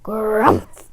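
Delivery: a gruff bear grumph; bear growl. A narrator's reading. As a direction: grunt it like a sleepy bear. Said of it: "growled Old Bear".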